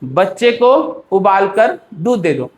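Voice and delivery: slow, short break after बच्चे को and after उबाल कर; three measured groups: बच्चे को, उबाल कर, दूध दे दो; comma pauses. Shown as a demonstration. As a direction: pause on both sides of उबाल कर. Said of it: "कहाँ पे मैं पॉज दे रहा हूँ".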